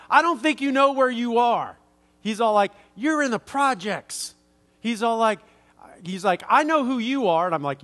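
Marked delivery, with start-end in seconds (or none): 0.1-1.8 higher, louder, demanding challenge; stranger's high, loud aggressive challenge; aggressive confrontation. Retold or imitated. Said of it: "real aggressively". 3.0-4.3 aggressive confrontation. Retold or imitated. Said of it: "real aggressively". 6.5-7.5 higher, louder, demanding challenge; stranger's high, loud aggressive challenge; aggressive confrontation. Retold or imitated. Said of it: "real aggressively".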